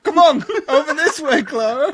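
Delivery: calling out. Pretend. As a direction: call out to coax her attention. Imagined nun calling for Clara's attention.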